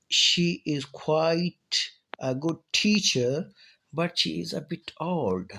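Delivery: put-on very slow, falling on quite, rising on teacher, falling on old; intonation demo. Shown as a demonstration. Teacher demonstrates intonation to students, quite down, teacher up, old down. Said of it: "quite पर आवाज़ जो है down हो रही है".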